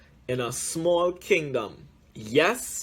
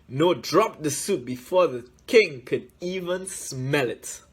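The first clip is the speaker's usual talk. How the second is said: ordinary delivery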